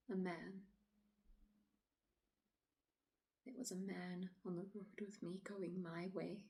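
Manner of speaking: quiet, halting dramatic monologue, long pauses; troubled woman recounting. Acted. Woman telling officer of walking alone at dusk, uneasy and hushed. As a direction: relive it softly, leaving silences between phrases.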